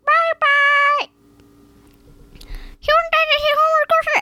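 chipmunk-fast high squeak; fast high parrot toy playback; sped-up high toy voice. Acted. Sped-up parrot toy repeating goodbye.

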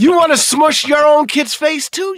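high, loud comic outburst; mock-exasperated yell; exasperated shout. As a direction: shout it as an outraged question. Comic exasperated callback about his kid.

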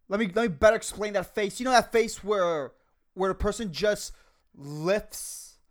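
normal talking voice

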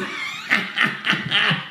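high breathy demon cackle; impish cackle. Acted. Demons laughing at Tom, high impish cackle. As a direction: cackle like a wicked imp.

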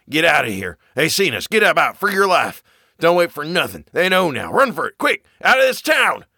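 frantic, climbing high and loud to a shout; panicked yelling. Acted. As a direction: urge him to run for his life. Zadok panics, urges flight.

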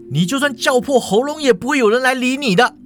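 high, heated villain line; threatening man. A narrator's reading. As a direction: menace the woman in melodrama style. Acted threat in quarrel.

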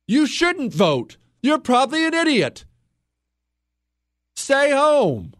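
real anger boiling over, pitch way up; furious host telling listeners to stay home; upset. Real, not acted. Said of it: "Now I'm upset. I gotta calm down".

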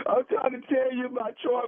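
put-on crying. Grieving brother act for the heart recipient, tearful. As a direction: choke it out as if crying. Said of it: "are you crying, man".

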